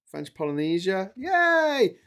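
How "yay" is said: high, loud little cheer of relief; excited cheer. Real, not acted. Streamer finishing French Polynesia, cheering high and loud.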